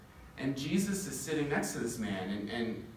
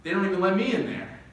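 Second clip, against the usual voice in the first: Jesus answering; 4 semitones higher, 4 dB louder, faster than usual. Retold or imitated. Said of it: "he said".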